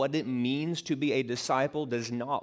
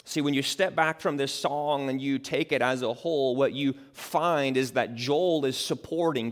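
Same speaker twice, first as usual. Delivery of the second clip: normal talking voice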